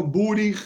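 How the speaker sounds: Burich, with u and i vowels; Hungarian Burich. Shown as a demonstration. Teacher gives the Hungarian variant. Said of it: "húngara, por ejemplo".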